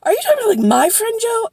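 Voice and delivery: very high, loud double-check; astonished question. Retold or imitated. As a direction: imitate someone suddenly catching on. Retold friend's sudden realization.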